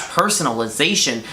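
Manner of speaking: ordinary talk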